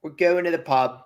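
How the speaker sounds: lightly put-on British pub voice; a hint of northern English; a casual mock-British voice. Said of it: "what part of Britain is that from?".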